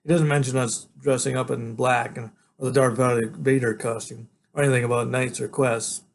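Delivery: usual speaking voice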